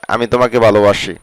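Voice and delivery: usual speaking voice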